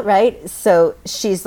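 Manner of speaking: usual speaking voice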